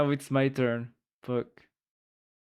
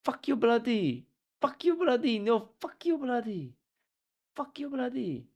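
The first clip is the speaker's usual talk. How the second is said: imitating a British Indian accent, higher and hammed-up; mock Indian accent; 9 semitones higher than usual. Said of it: "British Indians, uh, insulting each other".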